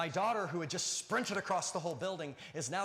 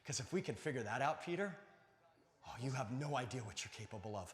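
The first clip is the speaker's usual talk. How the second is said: hushed, lower and intimate; tender reassurance; 4 semitones lower, 5 dB quieter than usual. Acted. Jesus telling Peter what he will do, tender and hushed. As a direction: drop low and soft, gently confiding.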